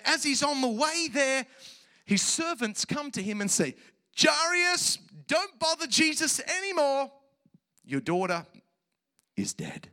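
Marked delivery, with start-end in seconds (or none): 4.2-7.1 high, loud urgent call; acted messenger's high shout; servants calling out. Acting servants calling out bad news. 7.8-9.9 acted solemn announcement with heavy pauses; grave news. Acting servants breaking death news.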